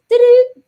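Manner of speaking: high, loud beeping imitation; loud rem pod beeps. Imitating the rem pod going off, loud high beeps. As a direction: belt out the alarm's two high beeps. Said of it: "and then it goes".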